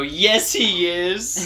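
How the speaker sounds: put-on high, whiny kid insisting; insistent child. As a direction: play a stubborn little kid. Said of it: "You didn't go".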